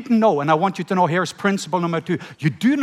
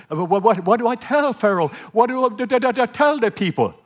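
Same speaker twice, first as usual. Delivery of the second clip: stammering Moses; 6 semitones higher than usual. Retold or imitated. Moses stammers excuses to God.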